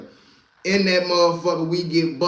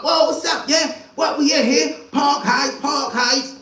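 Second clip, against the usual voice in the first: high hyped-up shouting; mock hype yell in the bar; excited yelling; 8 semitones higher than usual. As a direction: shout it like a wild hype man.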